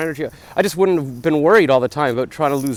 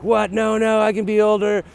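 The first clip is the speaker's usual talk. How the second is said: high, louder mock-indignant outburst; shocked protest; 9 semitones higher, 4 dB louder than usual. To a woman at the bar, a mock-shocked high protest. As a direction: pretend outrage at being called too young. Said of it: "And I'm like".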